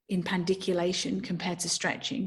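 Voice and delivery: ordinary talk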